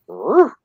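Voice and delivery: short rising, loud "roo"; little dog noise. Retold or imitated. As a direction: imitate a little dog's yip. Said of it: "like a little dog".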